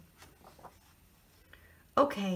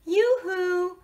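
high, loud yoo-hoo call; 10 semitones higher, 8 dB louder than usual. Acted. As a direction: sing out to get attention. Auntie calling out.

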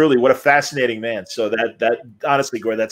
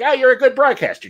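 imitating Studs; 13 semitones higher, 3 dB louder than usual. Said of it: "he's like".

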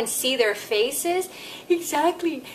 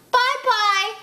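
put-on baby shouting bye; 8 semitones higher than usual. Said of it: "Till he shouted out".

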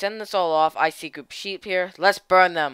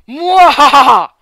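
evil cackle; 5 semitones higher, 15 dB louder, slower than usual. Acted. Villain laugh.

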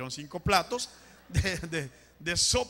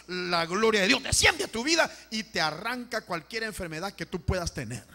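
ordinary talk

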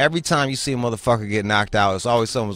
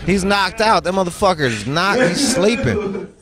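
horrified amusement; 10 semitones higher, 4 dB louder than usual. Real, not acted. Reacting to knocked-out kid.

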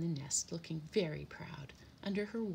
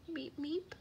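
high little "meep, meep"; worried small bird voice; worried sparrow; 10 semitones higher than usual. A narrator's reading. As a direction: peep anxiously, way up high. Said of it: "said the very worried sparrow".

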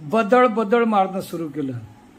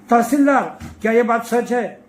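raised, higher officer's voice; loud demand; 3 semitones higher, 6 dB louder than usual. Retold or imitated. SDO questioning the tahsildar before villagers, loud demand. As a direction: call the subordinate to account, loudly.

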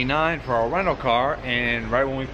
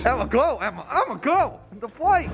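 urgent shouting; 13 semitones higher than usual. Real, not acted. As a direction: yell at her to hurry. Rushing to catch the flight.

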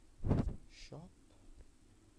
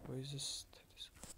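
whisper; 13 dB quieter than usual. Real, not acted. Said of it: "whispers to herself while searching".

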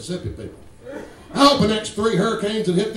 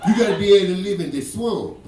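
put-on Cajun accent; 3 dB louder than usual. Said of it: "Louisiana got the French thing".

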